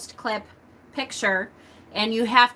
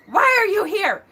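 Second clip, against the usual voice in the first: shrill alarmed question; 11 semitones higher, 5 dB louder than usual. Retold or imitated. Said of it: "And she's like".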